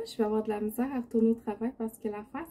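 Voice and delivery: normal talking voice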